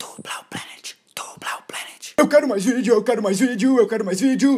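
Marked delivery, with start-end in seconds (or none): 0.0-2.1 hushed whispered channel name; whispering. Acted. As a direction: whisper it against plain version.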